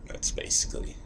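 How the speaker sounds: usual speaking voice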